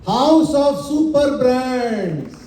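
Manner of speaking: drawn-out, emphatic title reveal; slow, booming unveiling of a name; grand announcement. Real, not acted. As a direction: stretch each word like a stage announcer. Unveiling the company's new title.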